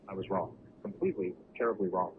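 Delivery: usual speaking voice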